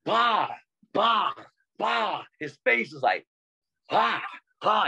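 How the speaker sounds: high punchy bah beats; popping beat sounds. Retold or imitated. Mimicking the dancer's popping hits, high and punchy. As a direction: punch out each bah like a hit.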